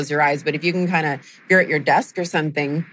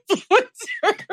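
high breathless laughter; laughing; 14 semitones higher, slower than usual. Real, not acted. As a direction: crack up helplessly. Speaker cracks up mid sentence.

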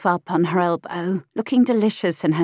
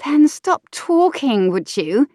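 put-on higher, slower, pert young woman; saucy mistress voice, high; 7 semitones higher, 4 dB louder, slower than usual. Mistress snapping at the earl, pert high voice. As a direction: tease him back, pitched up and slow.

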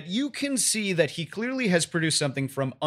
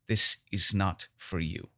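quiet menace; 6 semitones lower, 4 dB quieter than usual. Acted. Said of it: "looks at you very angrily and very dirtily".

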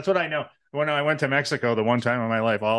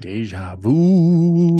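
eerie voice; 6 semitones higher, 8 dB louder, much slower than usual. Pretend. Spooky deja vu remark.

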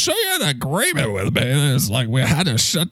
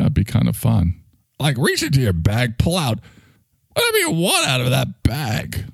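usual speaking voice

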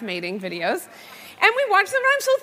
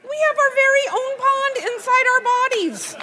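high sing-song explaining; 10 semitones higher than usual. Retold or imitated. Absurd explanation to daughter.

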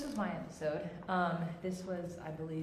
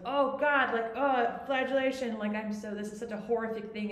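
hammed-up horrified apology, high and loud at the start; mock self-flagellation; 5 semitones higher, 6 dB louder than usual. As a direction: overdo the guilt, bursting out then easing off. Acting out an over-apologizer's self-flagellation.

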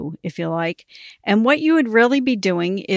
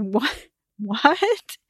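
a stunned what, then a higher laughing what; laughing disbelief; 3 semitones higher than usual. Retold or imitated. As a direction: react with amused disbelief. Hearing about worm poo.

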